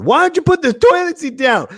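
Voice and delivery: very high, loud mock partner's complaint; mock nagging yell. Imagined significant other yelling about the toilet, mock high yell. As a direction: screech it like a hammed-up angry partner. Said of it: "if someone's yelling at you".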